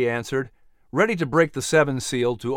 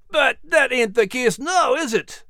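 Scottish dog growl; 8 semitones higher than usual. Acted. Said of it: "Max growled".

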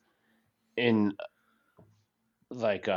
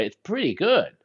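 higher, pleasantly surprised pretty good; surprised approval; 9 semitones higher than usual. Shown as a demonstration. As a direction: sound surprised it's good. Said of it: "you didn't think it was gonna be as good".

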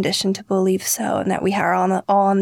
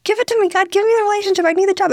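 high, louder whiny begging to God; desperate pleading; 11 semitones higher, 4 dB louder, faster than usual. Retold or imitated. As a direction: beg God like a grabby child. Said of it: "We're like".

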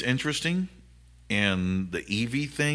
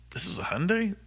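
high, amazed disbelief; surprised; 12 semitones higher than usual. Retold or imitated. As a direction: gasp it out in disbelief. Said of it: "and I'm going".

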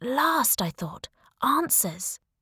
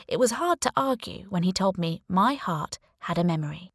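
plain reading voice

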